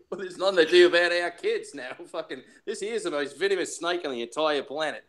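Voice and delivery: hammed-up Aussie mobster with laughs; broad joke Australian, amused; Australian accent. Mobster talking kids and snakes, mock Australian accent. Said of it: "has an Australian accent".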